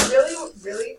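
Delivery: overjoyed and unbelieving; mother cannot believe the news; excited disbelief. A narrator's reading. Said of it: "was all my mother uttered".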